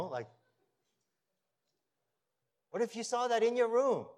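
a pastor pressing the point home from the pulpit; loud, slowing sermon delivery on the key lines; fervent preaching. Acted. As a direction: preach it with rising force and long pauses. Preaching, loud and slow in places.

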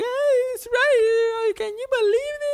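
very high, wailing American mom impression; mock outraged mother. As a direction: chime in squealing, hammed-up indignation. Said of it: "la mamá atrás como que".

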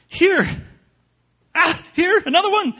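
high, loud panicked wail; frantic wail. A narrator's reading. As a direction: shriek as fleas keep appearing. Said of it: "I wailed".

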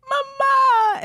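very high, drawn-out mock child's call; little girl's cry. Little girl on the escalator to her mother, high imitated call. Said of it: "And you hear, like".

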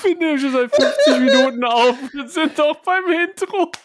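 half-laughing protest. Real, not acted. Laughing fit continues. (lachend, hohe Stimme)

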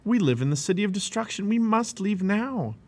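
high, urgent warning slowing at the end. A narrator's reading. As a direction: warn urgently, then draw out the last words. Urging family to flee.

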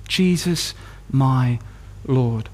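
low, word by word with pauses; reverent. Acted. Preacher closing on Christ's name, low and reverent. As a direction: let each word settle, dropping deep in reverence. Reverent close of quoted verse.

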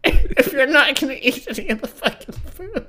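loud, high, laughing outrage; laughing rant. Real, not acted. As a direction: rant while cracking up.

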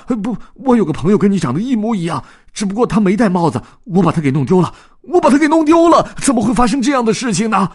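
high, fast, loud fluster; panicked. Acted. Dodds explaining to the lookalike duck, high and flustered. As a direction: babble it in a frightened rush. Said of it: "他顿时惊慌失措".

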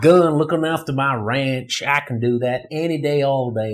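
put-on Southern rancher accent; Southern cowboy swagger in the accent; Matthew McConaughey. Shown as a demonstration. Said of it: "like a Matthew McConaughey on a farm".

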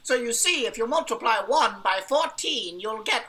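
loud, high, oblivious lecturer; lecturing math enthusiast. Acted. Knight explains multiplication to Fred, loud and self-absorbed. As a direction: hold forth loudly, stretching the final phrase.

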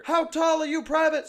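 high, loud barking drill sergeant. Retold or imitated. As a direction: yell at a recruit like a drill sergeant. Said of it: "drill sergeants".

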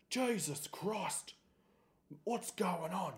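slow, high startled outburst; a father jolted awake at the door; shocked father. Retold or imitated. Father opens door at night.

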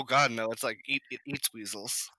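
ordinary delivery

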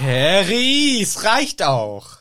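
fed-up, stretched-out telling-off; exasperated scolding. Acted. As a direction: scold him loudly, dragging out the words.

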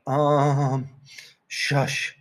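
mumbled sleepy shushing. Acted. Mom hushing them from bed, half-asleep. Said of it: "She made a noise like".

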